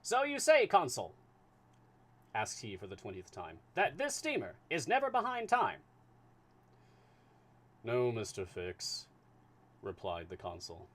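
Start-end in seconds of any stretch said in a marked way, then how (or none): 0.0-1.1 put-on high, loud transatlantic accent; booming high transatlantic detective; transatlantic voice for Fix. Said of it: "need a voice for Fix". 3.7-5.8 put-on high, loud transatlantic accent; booming high transatlantic detective; transatlantic voice for Fix. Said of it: "need a voice for Fix". 7.8-9.1 lightly voiced slow, steady official; unhurried, measured consul.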